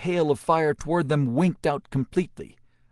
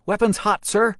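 loud, high, hard reply; sharp, clear voice; 9 semitones higher, 6 dB louder than usual. A narrator's reading. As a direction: answer hard as diamond. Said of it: "a sharp, clear voice, as hard as diamond".

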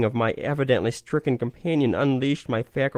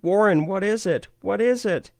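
high, pleading terrified repeat; 9 semitones higher than usual. A narrator's reading. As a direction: ask it again and again in terror. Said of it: "Terrified, I continued to repeat".

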